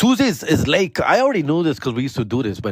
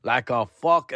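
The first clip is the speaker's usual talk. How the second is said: ordinary talk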